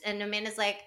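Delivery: ordinary delivery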